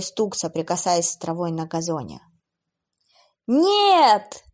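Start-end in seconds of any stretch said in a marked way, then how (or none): 3.5-4.4 high, loud frantic cry; hysterical scream. Acted. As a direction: shriek in horror. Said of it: "истошно закричала Эбби".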